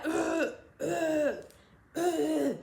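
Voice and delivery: mock retching groan; hammed-up vomiting sounds. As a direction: imitate gagging over the toilet. Retelling throwing up.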